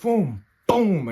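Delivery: high, punched-out bomb booms; imitated explosion. Man describing a thrown tear bomb, imitated blast. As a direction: mimic a bomb launching and bursting.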